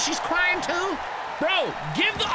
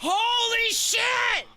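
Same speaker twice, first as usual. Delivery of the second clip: stunned exclamation; 7 semitones higher, much slower than usual. Real, not acted. Fan shocked by the match twist, drawn-out and high. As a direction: let the shock stretch out.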